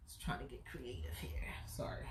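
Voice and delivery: unvoiced whispered aside; whispering. Real, not acted. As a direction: whisper it to yourself.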